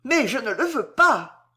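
high cry of protest; panicked cry. A narrator's reading. Queen terrified of losing Concini, crying out. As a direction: cry out the refusal, pitch jumping. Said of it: "Marie cria".

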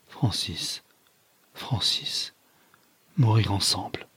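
faint, husky half-whisper; soft, veiled dying plea. A narrator's reading. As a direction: murmur it, voice nearly gone. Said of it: "d'une voix voilée".

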